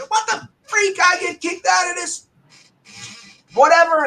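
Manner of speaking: high-pitched indignant griping; retold pissed-off outburst. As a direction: protest in exasperation, pitch way up. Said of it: "all pissed off, you know, like, saying".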